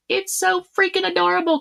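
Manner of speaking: high-pitched real gush of adoration. Real, not acted. Reader showing viewers a sweet card, high-pitched gushing. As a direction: squeal over something cute.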